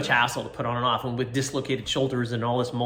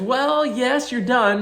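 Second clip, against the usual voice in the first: high, louder, bright-toned mock nurse; cheerful nurse; 13 semitones higher, 7 dB louder, much slower than usual. Imitating the nurse telling the groggy patient it's over. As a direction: chirp it like an amused nurse. Nurse quoted answering him.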